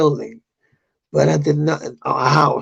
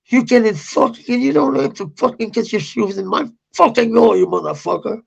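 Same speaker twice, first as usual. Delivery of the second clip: higher-pitched retelling of an angry rant; retold angry outburst; 7 semitones higher, faster than usual. Telling a cop off for shoes in his door, retold anger. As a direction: replay the outburst in a raised pitch.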